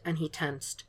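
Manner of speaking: usual speaking voice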